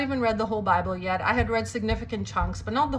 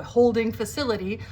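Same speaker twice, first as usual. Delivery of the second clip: usual speaking voice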